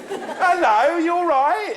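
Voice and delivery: high, loud music-hall greeting; loud hailing. Real, not acted. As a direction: call cheerily across to the audience. Hails audience members across the room.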